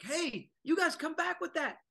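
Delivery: high, loud mock-indignant yell; indignant protest. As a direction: squeal it like a man robbed of his couch. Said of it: "He's like".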